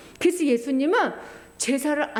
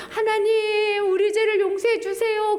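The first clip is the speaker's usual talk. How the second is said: high pleading prayer; 7 semitones higher than usual. Acted. High priest praying.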